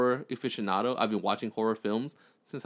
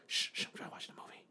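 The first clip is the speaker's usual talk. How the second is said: imitating a movie watcher's hushed whisper; quick, voiceless mock-annoyed shush; 14 dB quieter, faster than usual. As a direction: shush them, then whisper fast and very softly. Imitating dates shushing him during movie.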